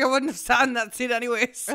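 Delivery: loud and high, laughing through it; laughing while quoting Remy. Retold or imitated. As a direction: laugh as you say it.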